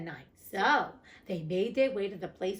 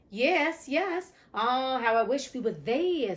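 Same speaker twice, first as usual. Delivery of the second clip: eager donkey voice; 4 semitones higher, 5 dB louder, slower than usual. Acted. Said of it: "said the donkey".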